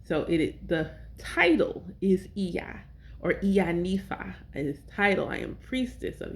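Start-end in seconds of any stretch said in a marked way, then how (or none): 2.4-2.8 Iya said slowly and clearly; careful pronunciation of Iya. Shown as a demonstration. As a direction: sound out Iya for the listener. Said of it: "I wanna know how to say it".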